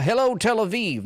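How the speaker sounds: high, loud crowd greeting; hammed-up rally opener; Lindsey Graham impression. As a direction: shout hello like a stumping politician. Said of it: "He's like".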